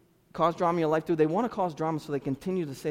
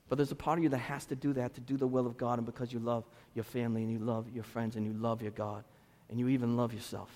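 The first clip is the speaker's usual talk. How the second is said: much softer, fading on each line; quiet sorrow; 5 semitones lower, 7 dB quieter than usual. Real, not acted. Pastor admitting heartbreak to his church, softly. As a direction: say it gently, near tears. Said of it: "I don't wanna cry".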